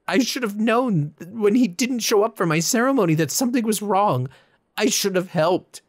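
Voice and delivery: loud, high grieving rant; anguished raging. A narrator's reading. Confessing his brother's death to the statue, anguished raging. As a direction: pour out the guilt at full volume. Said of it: "continued raging".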